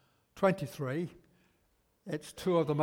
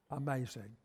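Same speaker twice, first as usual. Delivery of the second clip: ordinary talk, nothing marked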